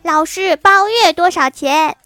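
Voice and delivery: put-on high, loud, drawn-out child's question; shouted punchline, stretched and bold; loud announcement. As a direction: call it out to the teacher, slowly and high. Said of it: "这时你站起来大声说".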